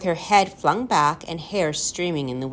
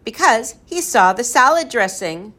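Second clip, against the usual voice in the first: higher, gleeful group answer; yelling back; 5 semitones higher, 4 dB louder than usual. A narrator's reading. Everyone answers Ben's lobster riddle, yelling back. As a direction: call the punchline right back. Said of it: "everyone yelled back".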